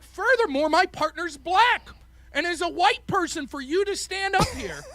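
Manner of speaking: high, grandstanding debate accusation; mock righteous outrage. Debater defending partner to opponent, mock righteous outrage. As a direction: pile on the charge with feigned indignation.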